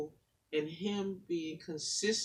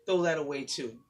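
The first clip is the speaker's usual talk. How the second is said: rhythmic spoken word, building in force; poem recital; 4 semitones higher, 10 dB louder than usual. Acted. Guest reading her poem to the audience, building spoken word.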